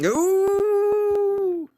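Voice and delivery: surprised, high and loud; high excited ooh. Real, not acted. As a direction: squeal the ooh with delight. Reacting to secret news.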